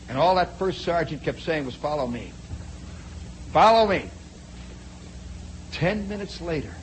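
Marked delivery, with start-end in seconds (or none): 1.7-2.3 low, soft "follow me"; low-key order. Retold or imitated. First sergeant leading prisoner away, low quiet order. 3.5-4.1 louder, sharper "follow me"; firm order. Retold or imitated. First sergeant leading prisoner away, firm loud order.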